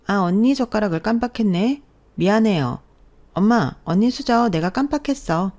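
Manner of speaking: sugary apology that is not sincere; fake apology. Sister-in-law to the wife at dinner, falsely sweet.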